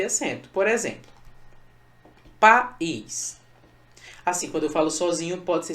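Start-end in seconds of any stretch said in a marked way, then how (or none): 2.4-3.4 PA, pause, ÍS in "país"; "país" broken at the hiatus; split into syllables. Shown as a demonstration. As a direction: say "país" as two separate beats, pa - ís. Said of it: "Como é que eu separo país?".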